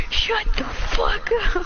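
high, drawn-out mock retort; imitating brother. Child quotes brother to a friend, high drawn-out imitation. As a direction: mimic a brother's outburst, stretched out. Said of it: "my brother just like".

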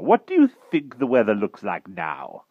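high, loud bumbling knight; blustering old knight. Acted. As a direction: bluster like a dotty old king. Pellinore voice.

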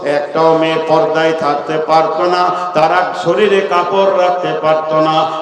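loud sing-song sermon chant; full-force preaching. Acted. (উচ্চস্বরে সুরেলা ওয়াজ)

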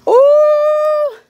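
high, loud ooh of delight; loud high surprised ooh. Real, not acted. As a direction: squeal at the gift. Reacting to a present.

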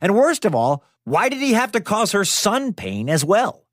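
ordinary delivery, nothing marked

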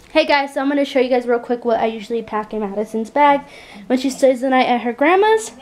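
ordinary talk, nothing marked

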